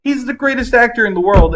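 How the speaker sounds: high, loud gushing praise. Real, not acted. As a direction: rave about your favourite actor. Gushing over Jeremy Irons.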